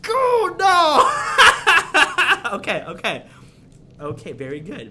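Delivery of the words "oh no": shouting oh no through laughter; startled laughing shout. Real, not acted. Spilled during the robot game.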